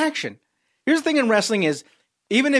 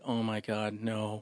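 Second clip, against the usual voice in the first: very low, hushed dismay; soft, sinking mock horror; aghast onlooker; 7 semitones lower, 13 dB quieter, slower than usual. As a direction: murmur it under your breath in dread. Backstage people aghast at bit.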